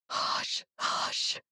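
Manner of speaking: soft whispered shushing; gentle, hushed comfort; soothing hush. A narrator's reading. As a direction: whisper to calm a frightened child. Aunt soothing the shaking girl.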